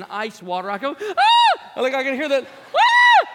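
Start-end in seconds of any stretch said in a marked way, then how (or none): 1.0-1.8 piercing high shriek; girl scream. Retold or imitated. Grabbed in the elevator dream, high girl scream. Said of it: "You know, girl scream". 2.7-3.3 piercing high shriek; girl scream. Retold or imitated. Grabbed in the elevator dream, high girl scream. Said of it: "You know, girl scream".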